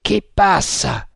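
slow, drawn-out call to a passing boat; shouted challenge. A narrator's reading. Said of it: "gridò una di quelle voci". (grido lento da sentinella)

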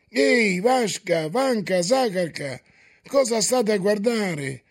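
voices of fussing parents and aunts shouting. A narrator's reading. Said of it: "gli gridavano".

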